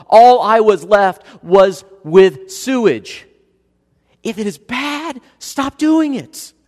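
high, loud and slow; fiery preaching. Acted. Pastor hammering the moral point, high and loud. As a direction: drive the point home from the pulpit. Pulpit emphasis building.

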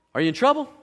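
usual speaking voice

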